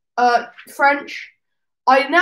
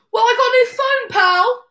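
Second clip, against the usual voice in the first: put-on proud kid; 10 semitones higher, 4 dB louder than usual. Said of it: "I was like".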